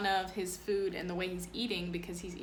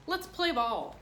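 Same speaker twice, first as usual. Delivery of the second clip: bright, higher call to start the game; eager call; 8 semitones higher, 5 dB louder than usual. Acted. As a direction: call the others to play. Boy calls to play.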